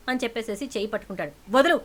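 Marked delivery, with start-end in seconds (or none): 1.5-1.8 irritated. A narrator's reading. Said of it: "అని చెప్పి విసుక్కుంటుంది".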